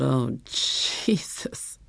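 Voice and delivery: long, drawn-out whispered groan; breathy groan. A narrator's reading. Husband overcome as he pulls over, whispered groan. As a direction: groan it very slowly, almost all breath. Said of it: "and groaned".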